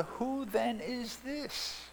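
slow, high-starting awed question; awed wonder. Acted. Disciples asking each other in awed wonder. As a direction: ask it in hushed amazement. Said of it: "said to one another".

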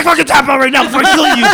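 high, very loud mock-furious yell; yelled threat. Fighter threatens his pinned friend, yelling. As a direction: scream the order over his refusal.

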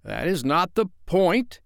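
high, emphatic retort. A narrator's reading. Father answering puzzled Wendy, retorting. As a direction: shoot back in protest. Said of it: "he retorted".

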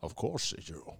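low, soft leprechaun-like brogue; Sean Connery voice. Acted. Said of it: "it's Sean Connery from the grave".

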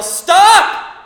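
sudden loud high shout; a father shouting a warning at his running child; yell. Retold or imitated. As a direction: yell it to stop a child in danger. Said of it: "and I yell".

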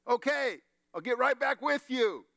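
high-pitched mock call back over a wall. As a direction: act out hollering a quick reply. Lady calls back over the wall.

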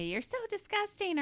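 high, mock-disgusted sneer; disgusted Fred. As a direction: recoil in exaggerated disgust. Imitating Fred's insult.

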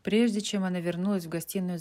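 usual speaking voice

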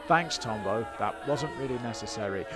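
normal talking voice

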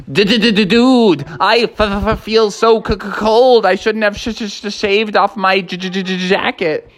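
chattering-teeth goat dude; shivering goat. Acted. Billy Goat regrets shaving his wool, shivering stutter.

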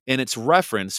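usual speaking voice

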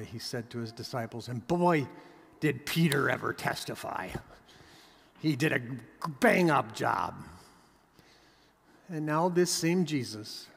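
ordinary delivery

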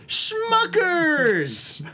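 high, long-drawn product-name holler; announcer shout. Pretend. Host unveiling a gag beer to his friend, announcer holler. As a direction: stretch it out like a hammy announcer.

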